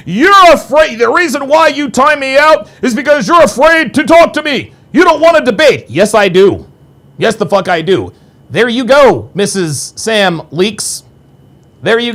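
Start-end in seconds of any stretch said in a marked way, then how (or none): 0.1-5.9 mocking chatter.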